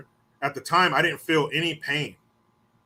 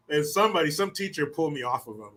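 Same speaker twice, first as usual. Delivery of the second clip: normal talking voice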